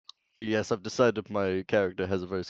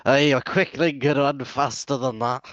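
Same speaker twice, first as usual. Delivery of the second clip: scoffing; 6 semitones higher than usual. Acted. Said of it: "he'll scoff and he'll go".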